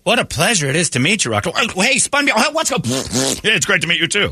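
high, squeaky starstruck greeting; starstruck fan. Retold or imitated. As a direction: gush at meeting a star. Guests greeting Rock.

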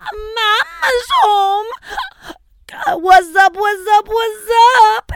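high, loud, drawn-out heckle; yelling. Retold or imitated. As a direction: scream it at the older kids. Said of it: "yell shit like".

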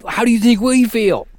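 loud, high mock indignation; exasperated retort. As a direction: shout back at an imagined complainer. Mock retort to imagined complaining listeners.

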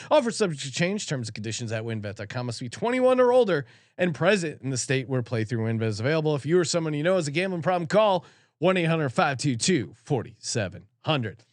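rushed fine print, quick; fast legal disclaimer. Acted. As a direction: rattle off the terms quickly.

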